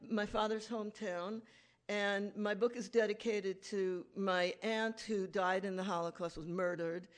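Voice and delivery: ordinary talk, nothing marked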